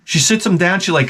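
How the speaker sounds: ordinary talk, nothing marked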